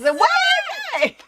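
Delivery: high, loud mock disbelief; incredulous. Grandmother at the shop seeing it happen, loudly incredulous. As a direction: exclaim it in hammed-up shock. Retelling shock at child peeing outside.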